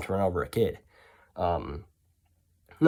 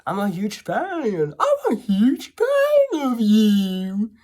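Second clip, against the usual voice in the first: high, very slow and dopey; dimwitted fan voice; 12 semitones higher, 7 dB louder, much slower than usual. Retold or imitated. Mocking the stalker's confession, slow dopey high voice. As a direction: drag it out like a starstruck simpleton. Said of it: "he's like".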